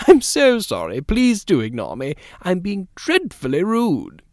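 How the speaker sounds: high, swooping lady's apology; effusive apology. Acted. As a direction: apologise gushingly, drawing out the last phrase. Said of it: "She laughed and apologized".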